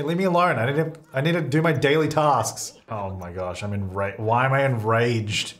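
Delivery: usual speaking voice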